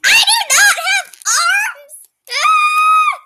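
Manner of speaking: loud high crying wail. Acted. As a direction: cry out in hammy despair. Snake wails about no arms.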